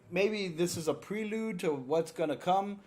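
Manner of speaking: ordinary delivery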